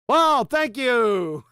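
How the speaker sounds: sarcastic thanks. Real, not acted. Sarcastic reply to a caller's insult.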